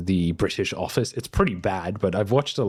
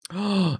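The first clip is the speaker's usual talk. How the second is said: high, startled gasp; sudden gasp; 11 semitones higher than usual. Real, not acted. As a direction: gasp as you suddenly remember. Suddenly remembers friend's show.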